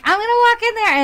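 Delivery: high, loud singsong self-quote; mock eagerness. Applicant picturing herself walking into the restaurant, mock eager. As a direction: pitch it way up, playfully eager.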